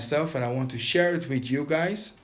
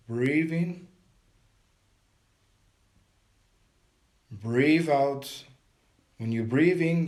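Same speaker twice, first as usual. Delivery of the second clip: guided meditation. Acted. Teacher guiding viewers through a breathing meditation, calm and steady. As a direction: coach them through each breath and hold, pressing on hold it.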